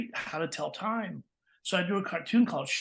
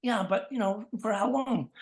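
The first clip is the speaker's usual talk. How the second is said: higher-pitched kid voice, playing along; child interviewer; 5 semitones higher than usual. Boy asking his football toy, childlike doubtful voice. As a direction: question the toy like a kid at play.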